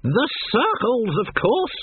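high, slow, sweetly innocent; innocent old woman. A narrator's reading. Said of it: "Granny radiated innocence".

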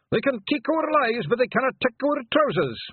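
high, loud battle yell; war cry. Acted. Pixie yelling his war cry before battle, loud and high. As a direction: bellow it like a charging pixie. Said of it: "each one had his own cry".

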